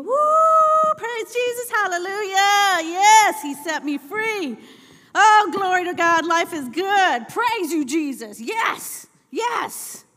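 whooping, high, shouted church praise; hammed-up ecstatic hallelujahs; so happy. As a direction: mimic an overjoyed worshipper at full volume. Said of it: "they were so happy".

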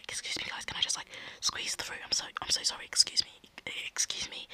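whispered apologies, squeezing through a crowd; sneaky whisper. Retold or imitated. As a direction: hush it, sorry to bother everyone. Will squeezing through the row.

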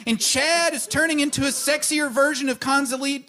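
high giddy rush. Acted. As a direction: squeal with delight. Giddy at wish visions.

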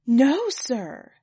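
loud, swooping denial; chorused denial. A narrator's reading. Everybody answering Sir at once, loud and emphatic. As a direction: answer for the whole crowd, emphatically. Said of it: "Everybody answered at once, speaking over each other".

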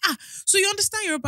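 high, quick mock surprise; surprised staff woman. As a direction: imitate her pouncing on a discovery.

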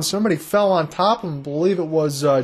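ordinary talk, nothing marked

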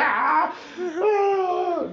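high, loud cries of laughter; helpless laughter. Retold or imitated. Stoned guy in the back seat, laughing helplessly. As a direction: laugh until it hurts. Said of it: "I can't stop fucking laughing".

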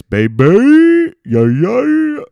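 sudden high, loud hype squeal; hyped-up squeal. Pretend. Comic hyping himself as Vin Diesel, high loud squeal. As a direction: shriek it like a revved-up hype man. Hyped car-ad bit, huge jump.

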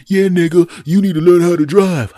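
high, loud yelled insult; imitated belligerent yell; cursing driver. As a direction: shout it back like an angry driver. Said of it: "begins cursing at me".

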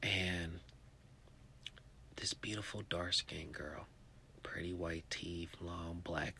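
soft, hushed admiration; awed whisper. A narrator's reading. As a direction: breathe out the description in awe. Describing girl in hush.

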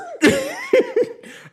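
a squealing laugh, loud and high; real laughter at her own joke; high burst of laughter. Real, not acted. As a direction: break into shrieking giggles. Laughs after botched accent.

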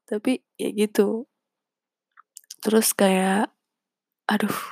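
normal talking voice

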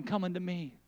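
hushed, gentle invitation; soft call. Acted. Preacher inviting someone to come, hushed. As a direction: call them in barely above a murmur. I hear the voice of God calling.

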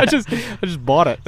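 high, silly imitation of a friend; a high mock-innocent friend. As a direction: mimic him in a high goofy voice. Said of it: "you were like".

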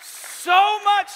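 high loud fervent preaching; preacher at full force; preaching hard. Real, not acted. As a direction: drive the point home from the pulpit. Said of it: "preaching at full force".

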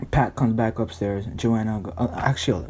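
normal talking voice

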